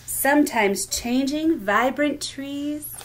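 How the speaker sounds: sing-song picture-book reading, lilting rhythm; reading to children. Acted. Teacher reading rhyming book aloud at storytime, lilting. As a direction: read each line like a gentle rhyme for little ones.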